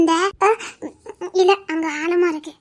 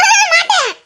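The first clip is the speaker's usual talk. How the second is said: high, loud laughing no; laughing refusal; 12 semitones higher, 7 dB louder than usual. Acted.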